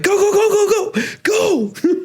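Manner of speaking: laughing mock urgency. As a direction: yell go like fleeing a cougar, laughing, very high. Brother yelling to flee.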